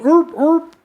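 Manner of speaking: high, loud barking seal-pup cry. Retold or imitated. Podcaster imitating a lost seal pup from documentaries, high yelps. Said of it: "a seal pup is going".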